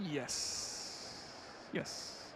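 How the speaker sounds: a hushed whispered yes; whispering yes to chat about being married. Real, not acted. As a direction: breathe the answer so nobody hears. Answering under his breath on stream.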